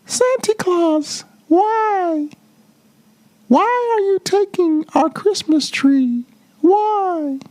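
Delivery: put-on a tiny girl asking in wonder; small child Cindy Lou Who. Cindy Lou asking Santa why he takes the tree, high and innocent. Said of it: "She stared at the Grinch and said".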